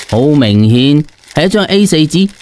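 ordinary delivery

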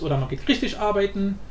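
ordinary delivery

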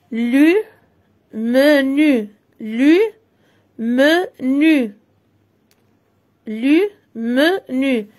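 teacher marking syllables for the class; syllable drill. Shown as a demonstration. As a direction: separate each syllable clearly. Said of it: "Je repère les syllabes".

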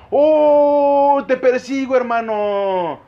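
hammed-up eager shout; worked-up excitement. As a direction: stretch it out with mock thrill. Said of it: "Me alteré, güey".

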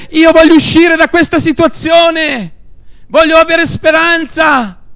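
high, loud impassioned plea; crying out. Acted. Preacher urging listeners to cry out to Jesus, crying out. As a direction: shout it out, pleading for hope. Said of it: "Griderai di dire".

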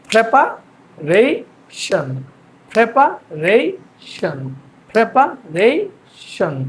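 প্রিপারেশন very slowly in three chunks, middle rising; syllable-by-syllable pronunciation. Shown as a demonstration. Teacher drills students, প্রিপারেশন very slow and broken up. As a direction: draw out prepa, rei, shon with long pauses. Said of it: "দেখেন".

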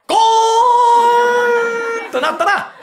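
long high sung gong; tolling temple bell. Acted. The tenant mimicking the temple bell at night.

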